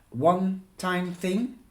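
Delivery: disbelieving rise on thing; incredulous echo. Acted. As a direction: throw the words back in disbelief. Fiona echoes it back incredulously.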